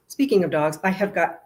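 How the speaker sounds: ordinary talk, nothing marked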